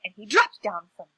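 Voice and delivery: usual speaking voice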